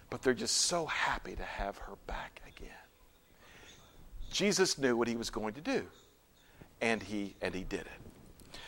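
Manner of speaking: very soft, low voice; hushed, low, reverent close; tender hush. Real, not acted. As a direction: end the story gently, almost whispering. Preacher closes story in hush.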